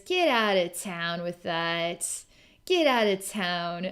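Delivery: slow, stretched-out teasing brush-off; smiling playful dismissal. Retold or imitated. Forest Princess answering sinister sarcasm, smiling playful dismissal. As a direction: draw each word out with a smile. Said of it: "she smiles and says".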